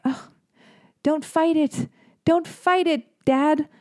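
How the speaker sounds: high, playful mock-seductive coaxing; teasing flirt.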